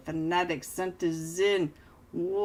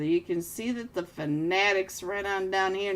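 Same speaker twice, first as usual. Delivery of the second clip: normal talking voice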